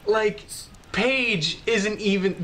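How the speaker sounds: ordinary talk, nothing marked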